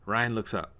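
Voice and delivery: ordinary delivery, nothing marked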